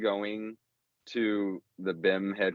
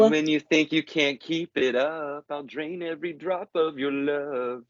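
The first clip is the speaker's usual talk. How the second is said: lyric recited higher in a put-on voice; a hint of sultry crooning; mock sultry lyric; 5 semitones higher than usual. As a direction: read the lyric as if it's seductive. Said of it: "That's subtlety at its finest".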